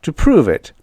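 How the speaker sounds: ordinary delivery, nothing marked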